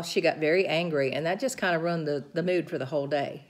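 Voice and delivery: ordinary delivery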